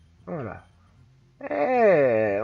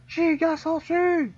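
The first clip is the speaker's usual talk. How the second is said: high, drawn-out character cry; attack call; 14 semitones higher, 3 dB louder, slower than usual. Acted. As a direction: call the move out high and slow.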